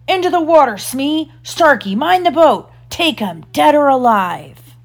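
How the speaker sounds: high, loud captain's commands; shouting orders. A narrator's reading. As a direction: bark orders to a pirate crew. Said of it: "Hook shouted".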